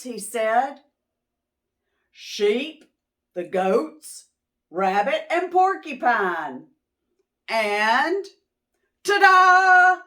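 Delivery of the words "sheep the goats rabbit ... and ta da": building up to a big ta-da; excited presentation. Acted. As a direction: announce the guests, then reveal. Mister Moose unveils Turkey proudly.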